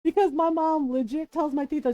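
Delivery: ordinary delivery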